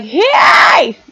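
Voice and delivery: short loud burst of screaming; borderline fry scream. Shown as a demonstration. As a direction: scream it out, edging into vocal fry. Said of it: "how I would scream is like".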